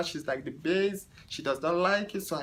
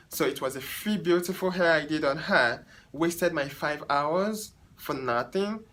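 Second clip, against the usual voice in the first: ordinary talk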